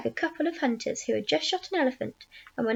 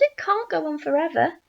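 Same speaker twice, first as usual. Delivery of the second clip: mildly raised, insistent higher voice; yell; 4 semitones higher, 4 dB louder than usual. A narrator's reading. Aunt insisting the growing must stop, moderate high yell. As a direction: insist it, pitched up a little. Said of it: "Aunt Spiker yelled".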